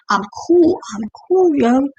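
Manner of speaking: high-pitched, self-mocking reassurance; mock-cool insistence. Retelling herself insisting she was fine.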